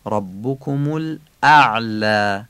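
ربکم الاعلی slowly, hamza and ayn each distinct in الاعلی; hamza and ayn separated. Shown as a demonstration. Teacher showing learners ربکم الاعلی, separate hamza and ayn. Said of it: "این همزه و عین از هم تفکیک باشن".

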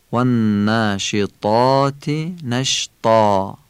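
والناشطات نشطا slowly, alef after ط thick; heavy alef. Shown as a demonstration. Teacher reading the whole verse slowly. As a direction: keep the alef in نشطا full.

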